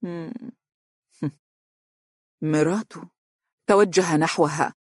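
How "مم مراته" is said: drawn-out hum, then a delayed answer; slow. A narrator's reading. She reveals the culprit to Amr bit by bit, slowly. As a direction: hold the answer back with long pauses. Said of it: "وهي تقول ببطء".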